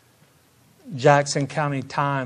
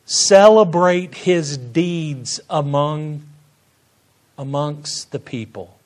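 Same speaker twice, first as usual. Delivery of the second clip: normal talking voice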